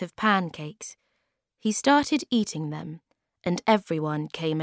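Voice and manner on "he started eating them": ordinary delivery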